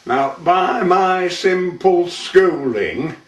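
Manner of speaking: measured, rhythmic dialect monologue; poem recitation. Acted. Man recites an old Burton tale to an audience, theatrical poem recitation.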